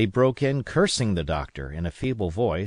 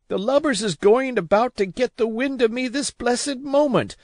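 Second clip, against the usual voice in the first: very high, loud defiant sea dog; a sick man blustering he'll beat them; furious boastful pirate, far higher; raging old captain; 12 semitones higher, 4 dB louder than usual. A narrator's reading. Captain rages against lubbers.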